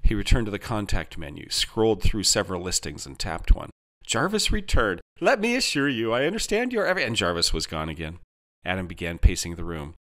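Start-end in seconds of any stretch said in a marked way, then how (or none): 5.2-7.0 high, over-eager reassurance; high-pitched customer-service placating; assuring AI helper. A narrator's reading. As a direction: launch into scripted assurance.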